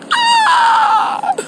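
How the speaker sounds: very high, hammed-up wail; mock drowning scream. Doubter sinking in the flood, mock scream. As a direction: shriek in put-on terror as you go under.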